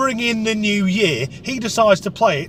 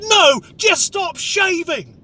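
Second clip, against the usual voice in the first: exasperated reply; 10 semitones higher, slower than usual. Retold or imitated. Curt reply to drunk.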